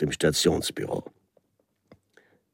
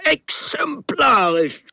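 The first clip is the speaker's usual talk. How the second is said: much higher, louder sputtering rage; furious official; 4 dB louder than usual. Acted. Red-capped official raging over the traveller's mess. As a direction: sputter it out, stumbling in fury. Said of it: "keuchte wütend".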